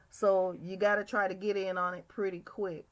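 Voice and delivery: ordinary talk, nothing marked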